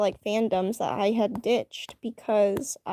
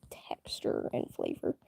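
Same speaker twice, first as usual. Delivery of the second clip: whisper; 11 dB quieter than usual. Real, not acted. Whispered trailing remark.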